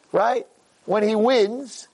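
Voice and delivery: ordinary talk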